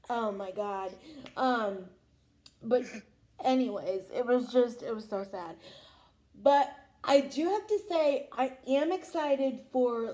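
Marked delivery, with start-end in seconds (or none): none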